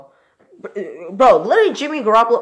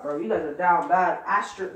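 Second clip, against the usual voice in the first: ordinary talk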